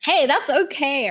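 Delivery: ordinary talk, nothing marked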